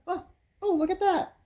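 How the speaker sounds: high, dreamy mock surprise; mock pregnant lady. Mom staring at her newborn baby, in a high airy mock voice. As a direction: squeak it out like a startled new mum. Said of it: "and she's like".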